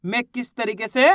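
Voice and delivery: ordinary delivery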